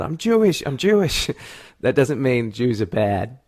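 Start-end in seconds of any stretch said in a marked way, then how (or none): 0.0-1.3 imitated yell. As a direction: re-enact a yell with raised pitch. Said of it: "when you yelled out".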